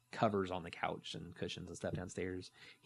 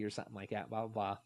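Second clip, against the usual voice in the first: normal talking voice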